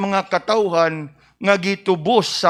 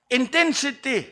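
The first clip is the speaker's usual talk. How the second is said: ordinary talk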